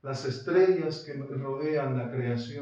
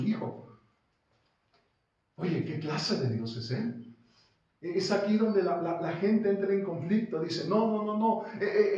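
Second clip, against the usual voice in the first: somewhat raised sermon voice; preacher voicing the doubters; lively preaching; 6 semitones higher than usual. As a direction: argue it out from the pulpit.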